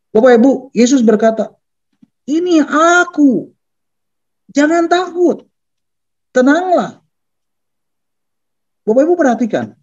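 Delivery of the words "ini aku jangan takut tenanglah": slow, high calls, spaced apart; calling out reassurance. Acted. Jesus makes himself known to disciples, calling out reassurance. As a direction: draw out each high phrase as a call.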